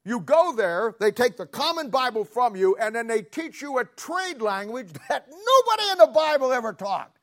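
high, loud preacher's complaint; fired-up preaching. Real, not acted. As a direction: build indignantly into a high, loud peak. Preaching at full force.

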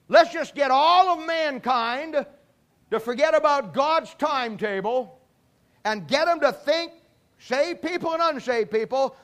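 the devil unveils his master plan; triumphant devil. Acted. Devil announces his winning plan.

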